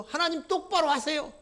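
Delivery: high, put-on sneering voice, 똑바로 stressed; mock God with a sneer on 똑바로; 똑바로 hit in a haughty, taunting voice; insolent mockery. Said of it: "이따위 식으로 얘기를 하죠".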